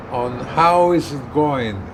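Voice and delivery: ordinary talk, nothing marked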